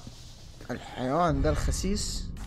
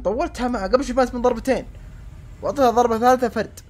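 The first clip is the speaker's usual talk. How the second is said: frustrated rant; 9 semitones higher, 7 dB louder than usual. Real, not acted. Frustrated rant after dying.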